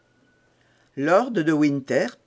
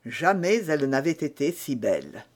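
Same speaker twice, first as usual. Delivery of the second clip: ordinary delivery, nothing marked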